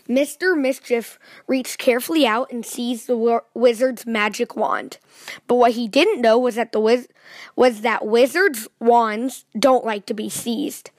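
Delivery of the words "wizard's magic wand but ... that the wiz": ordinary delivery, nothing marked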